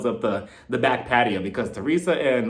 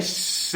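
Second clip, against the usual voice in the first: unvoiced shushing sound; hushing shh; 6 dB quieter than usual. Host shushes Jackie during the whispering, hushing. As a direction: hush someone sharply. Hushing someone who spoke up.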